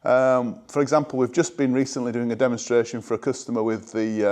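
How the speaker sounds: ordinary delivery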